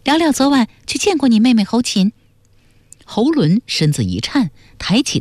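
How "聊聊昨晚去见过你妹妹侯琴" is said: high, quick character voice; high-pitched and direct; earnest young questioner. A narrator's reading. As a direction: press the point in a high, brisk voice.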